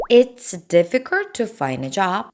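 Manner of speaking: slow, word by word; slow model sentence. Shown as a demonstration. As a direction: read it slowly for the class. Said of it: "일자리 구하기 어려워요". (천천히 또박또박 예문 읽기)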